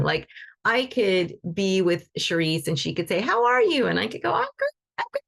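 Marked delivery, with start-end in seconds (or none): 3.2-3.9 friendly high how-are-you; a lightly voiced friend checking in; friend asking. 4.3-5.2 much higher, bright forced cheer; faking fine with a high sing-song; fake cheerful. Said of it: "my world could be falling apart".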